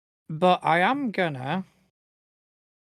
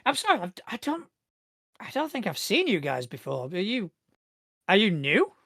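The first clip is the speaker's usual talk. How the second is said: higher-voiced, apologetic guard, unsure; security guard; 4 semitones higher than usual. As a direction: haltingly question people you don't recognize. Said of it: "security guard".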